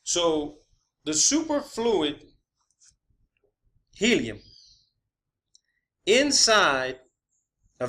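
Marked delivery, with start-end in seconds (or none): none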